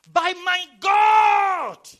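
huge high shout, long drawn out; triumphant preacher's shout. Real, not acted. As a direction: belt it out slowly to the congregation. Climax of preached verse.

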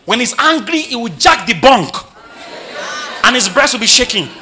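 high, loud, building animated rant; full-force preaching. Real, not acted. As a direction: tell it with mounting mocking exasperation.

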